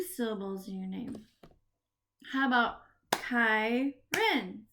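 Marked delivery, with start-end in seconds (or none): 3.1-4.6 Kyron split into "Ky", pause, "ron"; a clear gap inside Kyron; syllable by syllable. Shown as a demonstration. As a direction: clap out Kyron in two beats. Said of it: "we are gonna clap the syllable".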